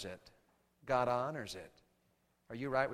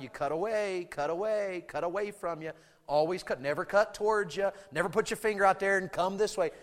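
usual speaking voice

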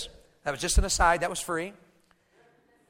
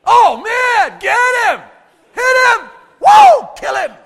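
high, loud shouts egging fighters on; excited battle cheer; 16 dB louder, slower than usual. Acted. Preacher playing David at the battle, shouting excitedly. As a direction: whoop and holler them on.

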